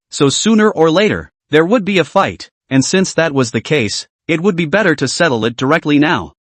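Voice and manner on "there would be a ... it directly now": usual speaking voice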